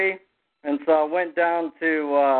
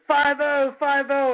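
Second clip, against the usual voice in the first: high-pitched mock alarm call; retold warning cry; 9 semitones higher than usual. Buddy warns the others of police, high retold cry.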